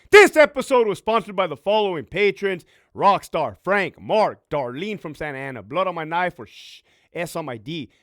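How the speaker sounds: loud, high, punchy announcer roll call; shout-out read. Real, not acted. Podcaster thanking his patrons by name, loud announcer shout-outs. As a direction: call out each name like a hype man. Reads sponsor patron list.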